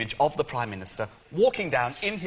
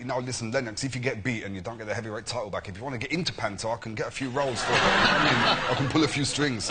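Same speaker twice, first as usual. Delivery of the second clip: Frank Bruno voice; 6 dB louder than usual. Retold or imitated. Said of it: "as Frank Bruno".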